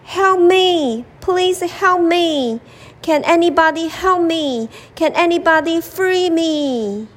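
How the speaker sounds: high, desperate cries for help; a trapped lion shouting again for help. Acted. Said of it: "The lion shouted again".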